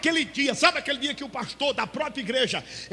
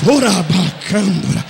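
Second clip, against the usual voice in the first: línguas lentas e graves; falando em línguas; 8 semitones lower, 8 dB louder, much slower than usual. Acted. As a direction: solte a língua estranha devagar. Fala em línguas. (glossolalia lenta e forte)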